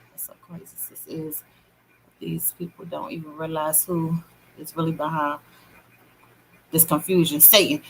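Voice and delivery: hushed, breathy aside to sis; whispered aside. Real, not acted. Confiding in a viewer about the confusion, a real whisper. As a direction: drop to a secret whisper.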